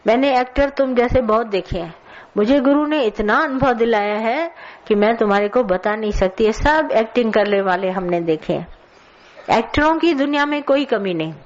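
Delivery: ordinary delivery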